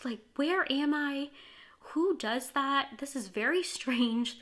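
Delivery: reliving her shock at the clinic's strange rule; stunned disbelief. Retold or imitated. Woman recalling her clinic visit, thinking in loud, slow disbelief. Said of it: "And I'm thinking".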